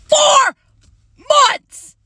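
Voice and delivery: high, loud outburst on four months; exasperated shout. Real, not acted. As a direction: blurt it out in disbelief. Exasperated about the long deception.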